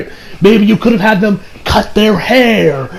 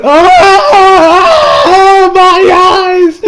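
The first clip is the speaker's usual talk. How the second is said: high shrieking wail of horror; hammed-up cry of pain, high and loud; mock anguished cry; 11 semitones higher, 4 dB louder, much slower than usual. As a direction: shriek like it burns your eyes. Mock horror reaction to scene.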